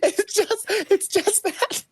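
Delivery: high, slow words through laughter; can barely get it out for laughing. Real, not acted. Laughing about the joke.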